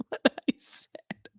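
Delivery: whisper. Real, not acted. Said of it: "mortified aside".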